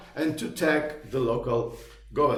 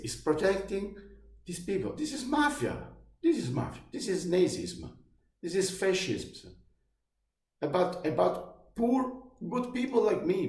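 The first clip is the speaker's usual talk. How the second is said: indignant outburst; 6 semitones higher than usual. Real, not acted. Ranting to viewers about the corrupt local law, indignant and high-pitched. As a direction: pitch jumps high with outrage, same volume.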